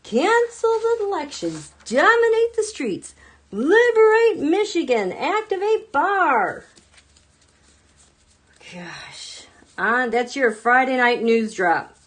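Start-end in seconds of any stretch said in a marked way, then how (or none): 0.0-6.6 high, mocking slogan read slowing at end; reading his post aloud in a high sneer. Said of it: "What is this?". 8.7-9.5 whispered gosh. Real, not acted. Dismayed aside.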